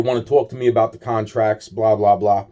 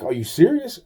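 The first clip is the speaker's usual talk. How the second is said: higher, incredulous question; retelling his stunned disbelief, pitched up; 7 semitones higher than usual. Retold or imitated. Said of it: "I'm like".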